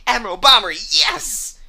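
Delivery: rally the crew like a blustering seaman; blustery seafarer, rising in excitement; gung-ho old sea salt. Acted. Voices old sailor setting off.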